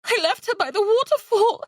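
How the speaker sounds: high tearful choke; choked up. Acted. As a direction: barely get it out, sobbing. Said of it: "she choked".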